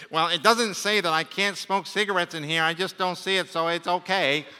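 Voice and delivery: lightly put-on voice of a rule-dodger; mock excuse-maker. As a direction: argue the loophole in a mildly mocking voice. Mocks someone excusing their own sins.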